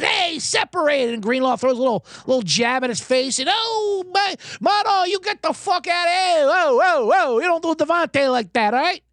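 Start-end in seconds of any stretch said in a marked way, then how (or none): 0.0-1.1 high, slow barked command; security guard shout. Retold or imitated. Big Dom separating the players, mock high bark. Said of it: "He goes". 3.5-9.0 high mock yell at a player; Big Dom yelling. Security guard telling Greenlaw off, high mock yell.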